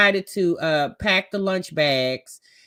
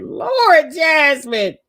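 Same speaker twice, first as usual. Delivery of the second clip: louder, higher exclaim of disbelief; exclaiming loudly; 7 semitones higher, 8 dB louder, much slower than usual. Retold or imitated. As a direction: call out her name in mock shock. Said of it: "I said".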